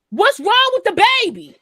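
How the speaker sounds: high, loud mock-angry demand; acted indignant demand. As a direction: call out like a fed-up mother. Acts out angry mother calling downstairs.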